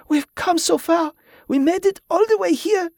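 very high, tearful protest; sobbing. A narrator's reading. Wife refusing to give up on her husband, high sobbing. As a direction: protest high, through sobs. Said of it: "she sobbed".

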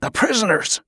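high-pitched shocked realisation; sudden alarm. A narrator's reading. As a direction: exclaim as it suddenly dawns. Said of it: "suddenly his eyes went wide".

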